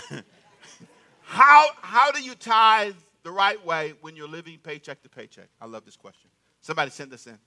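usual speaking voice

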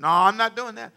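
high-pitched, insistent mock refusal; adamant refusal. Imitated pastor refusing to add a service, adamant. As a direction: imitate a pastor digging his heels in.

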